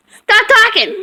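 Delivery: loud, high stern order; stern command. Real, not acted. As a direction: order the dog to stop. Ordering the dog.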